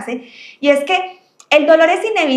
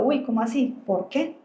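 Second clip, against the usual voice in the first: puzzled listener; 9 dB quieter than usual. Retold or imitated. Teacher voicing her listeners' puzzled question, softly. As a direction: voice the audience's quiet confusion. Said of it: "Se estarán acá preguntando".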